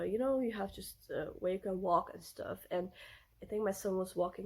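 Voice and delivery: ordinary talk, nothing marked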